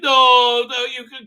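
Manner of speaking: loud, high mock protest; retold loud objection. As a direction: burst out with a big hammed-up no. King objects in retold scene.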